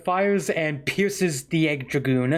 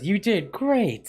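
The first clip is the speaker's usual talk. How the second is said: put-on sweet girl voice; 7 semitones higher, slower than usual. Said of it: "Amy just goes".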